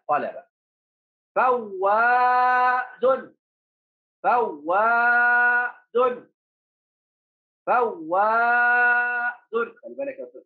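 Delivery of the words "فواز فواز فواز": فواز very slow, with the aa held on a high note; long alif. Shown as a demonstration. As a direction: dictate فواز, doubled w, long aa, final -un. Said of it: "في مد الألف".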